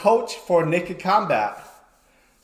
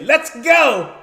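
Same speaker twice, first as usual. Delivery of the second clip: higher, louder fight start call; referee start call; 8 semitones higher, 4 dB louder than usual. Retold or imitated. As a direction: start the bout like a ref. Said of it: "I say".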